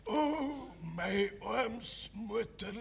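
faint, slow deathbed voice; breaking voice. Acted. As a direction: let the voice break, dying. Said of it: "mit brechender Stimme".

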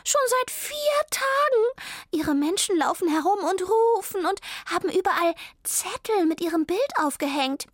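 worried high character voice; Zelda's voice. Acted. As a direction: play the little dog, worried.

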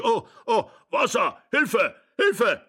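high, loud alarmed calling; old man's alarmed yells; panicked cry for help. A narrator's reading. Old man calls for help.

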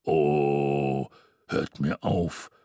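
Mister Xmas groaning at the mention of TV, deep and slow. A narrator's reading. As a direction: groan it out wearily. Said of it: "stöhnte Mister Xmas".

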